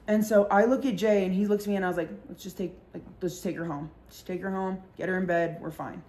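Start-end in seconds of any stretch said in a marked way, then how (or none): 2.3-6.0 low, much softer aside; quiet aside to a friend about taking her home; hushed aside to friend. Retold or imitated. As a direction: murmur the plan to your friend. Quietly telling Jay.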